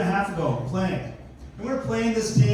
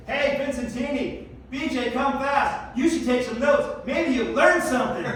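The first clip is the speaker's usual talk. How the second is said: higher-pitched imitation of a wife calling down; heckling wife; 4 semitones higher than usual. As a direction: tease him from the railing in a raised pitch. Wife heckling him from railing.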